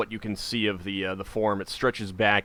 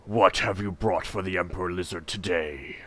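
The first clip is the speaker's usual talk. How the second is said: deep echoing voice; 2 semitones lower than usual. A narrator's reading. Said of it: "a deep voice echoes from the skull".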